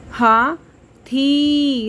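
हाथी drawn out with the थी held long; slow stretched. Shown as a demonstration. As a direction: read हाथी slowly. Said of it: "आवाज़ थोड़ी सी लंबी". (हाथी धीरे खींचकर)

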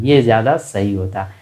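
ordinary delivery

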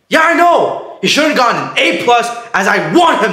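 high, worked-up complaint; outraged agreement. Acted. Mom fuming over her son's grade, outraged. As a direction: complain with full indignation.

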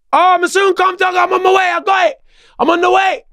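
high, breezy Jamaican-flavoured reassurance; Joe on the phone. Retold or imitated. Mate answering the phone, casually stalling with a high breezy voice. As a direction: pitch it way up, carefree and unbothered.

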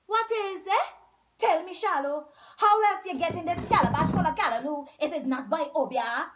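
loud, fast insistent questioning; pressing demand. A narrator's reading. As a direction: push him for the answer. Zaki pressing for answer.

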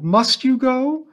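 high, slow wailing plea; wailed. A narrator's reading. The teacher begging Missus Bonney not to leave, wailing. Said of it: "I wailed".

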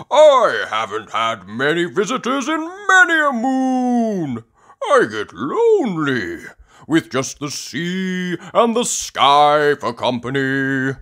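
high, loud, plaintive character voice; Grampy Rabbit. Acted. Grampy Rabbit alone on his rock, loud plaintive voice. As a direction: proclaim your loneliness grandly. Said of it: "exclaims Grampy Rabbit".

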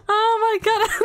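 high, squeaky, helpless laughing fit; laughing breathless. Real, not acted. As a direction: gasp the words out between bursts of laughter. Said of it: "I'm trying to breathe".

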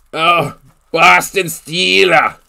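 slow, drawn-out mock Boston accent on "Boston Steeler"; playful attempt at a Boston accent. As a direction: try out a Boston accent on the team name, stretching it. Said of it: "do you actually have the Boston accent?".

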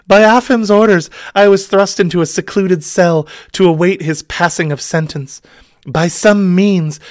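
high, breathy-free damsel voice. Acted.

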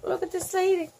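usual speaking voice

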